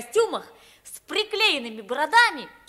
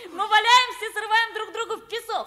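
laughing through the words, higher; giddy boy recalling summer fun; 7 semitones higher, 3 dB louder than usual. Acted. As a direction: talk while laughing happily.

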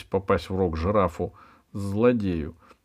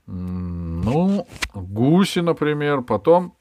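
drawn-out, higher thinking aloud; hum, then offer it tentatively, pitched up; hesitant pondering; 6 semitones higher, 6 dB louder, slower than usual. A narrator's reading. Джавад answering Пашка's question about birds, hesitant.

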